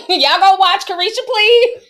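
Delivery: high-pitched, talking through laughter; amused, voice pushed up by giggles; laughing. Real, not acted. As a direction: crack up while you say it. Cracking up while mimicking [name].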